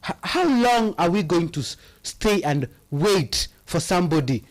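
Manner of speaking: ordinary delivery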